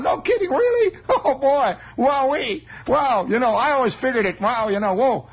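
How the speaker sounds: put-on high, giggly boy's excitement; gleeful kid. Kid excited by classmate's gossip, high and giggly. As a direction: gush like a thrilled kid, laughing.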